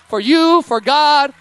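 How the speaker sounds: loud, high rally call to the church. Real, not acted. Pastor rallying the church to applaud, loud call. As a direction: lift the crowd into applause.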